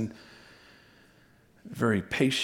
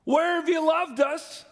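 higher, louder challenge; doubting people; 12 semitones higher, 3 dB louder than usual. Acted. The people answer God with doubt. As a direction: throw the doubt back at God. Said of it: "the people are responding".

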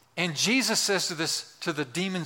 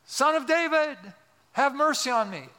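raised-volume cry for mercy; yelling louder; 9 semitones higher than usual. Acted. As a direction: call out over a crowd, pitched way up. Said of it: "He raises the volume".

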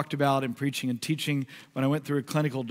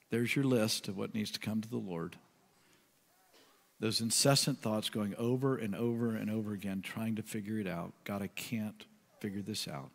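slow, softer and softer prayerful reading; slow meditative reading; 5 semitones lower, 7 dB quieter than usual. Acted. Pastor guiding the congregation to pray, soft and slow. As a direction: guide them into quiet prayer, slowly. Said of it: "I'm gonna read it slowly".

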